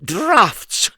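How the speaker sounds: very high squeaky Mister Nonsense; high silly voice. Acted. Narrator voicing Mister Nonsense, high and squeaky. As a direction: pipe it up like a daft little man.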